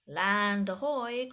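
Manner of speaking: loud, slow call from the deck; a child spotting land, called loud and slow; cried out. Acted. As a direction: call out to the whole crew. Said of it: "cried Violet".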